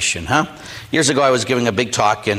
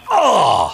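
higher, drawn-out groan; exasperated groan; 5 semitones higher, 3 dB louder than usual. Retold or imitated. Priest acting out his meltdown with an exasperated groan. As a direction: groan as if at the end of your rope.

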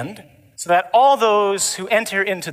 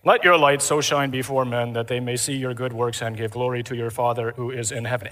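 low, droning Mass reading voice; monotone gospel reading; 4 semitones lower than usual. Shown as a demonstration. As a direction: read scripture in a low, lifeless monotone. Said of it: "Ever heard it read like that?".

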